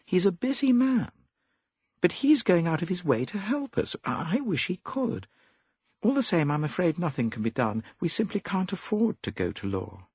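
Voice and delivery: put-on high, light young woman pleading; young woman's voice. A narrator's reading. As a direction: voice Molly as a worried young lady. Molly's lines in story.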